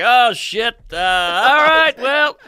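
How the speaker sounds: high, loud, stretched-out shrug; awkward backpedal. Retold or imitated. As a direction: brush off a disaster to the room. Acts his awkward stage backpedal.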